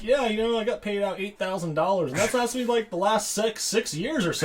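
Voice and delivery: high, bright imitation of the guy, a touch goofy; mock dopey movie voice; mock movie character quote. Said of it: "and he's like".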